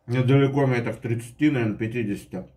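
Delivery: speaking between bites; chewing while talking. Real, not acted. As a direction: mouth partly full. Seen 1: Takes a forkful at -1.0, then talks with cheeks working.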